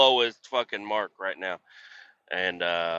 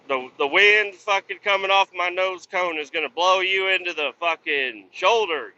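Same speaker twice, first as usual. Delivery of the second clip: ordinary talk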